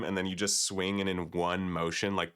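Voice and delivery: ordinary talk, nothing marked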